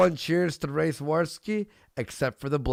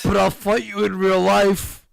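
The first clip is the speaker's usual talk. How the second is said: higher, louder mock challenge; mock tough guy; 6 semitones higher, 6 dB louder than usual. Host mimicking the internet tough guy, mock blustering challenge. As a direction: push it up into a blustering taunt.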